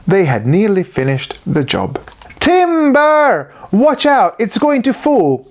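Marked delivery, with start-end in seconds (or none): 2.4-5.5 high warning shout; crying out. A narrator's reading. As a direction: yell a warning as the tree falls. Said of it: "they cried".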